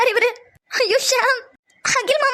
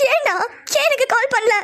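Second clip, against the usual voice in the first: ordinary talk, nothing marked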